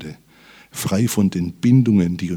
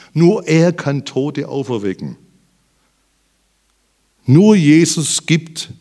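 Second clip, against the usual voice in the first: raised, ringing proclamation with punched words; preaching at full force; 8 semitones higher, 7 dB louder than usual. Acted. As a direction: proclaim it to the whole congregation. Höhepunkt der Predigt. (mit voller Kraft verkündet)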